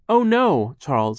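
high, loud cry of dread; alarmed. Acted. Violet fears for her brother, alarmed. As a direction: exclaim in sudden alarm.

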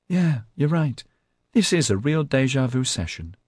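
high, light, falsely friendly tone; amiable. A narrator's reading. Melanie agrees as J C cries, amiably. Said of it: "she said amiably".

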